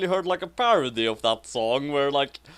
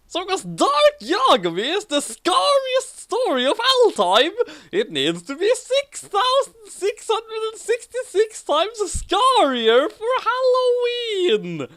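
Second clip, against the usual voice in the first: high, louder spooky-story voice, slowing on scarier; milk it like a campfire tale; hammed-up reading; 4 dB louder than usual. Reading the six-six-six line to her co-host, hammed up. Reads fanfic aloud dramatically.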